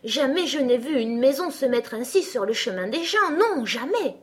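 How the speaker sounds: high, loud protest; indignant exclamation. A narrator's reading. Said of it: "s'écria-t-elle". (protestation indignée, voix haute et forte)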